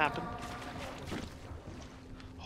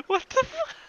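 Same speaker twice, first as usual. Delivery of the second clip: high startled disbelief; 4 dB quieter than usual. Real, not acted.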